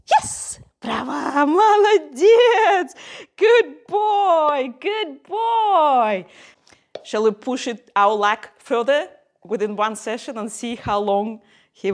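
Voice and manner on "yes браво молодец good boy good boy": high, loud gushing praise; excited praise. Real, not acted. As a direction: cheer the pup on. Praising dog after success.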